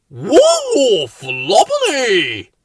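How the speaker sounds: loud, slow, high gasp of wonder; drawn-out amazed cheer; delighted. A narrator's reading. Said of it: "said Bill".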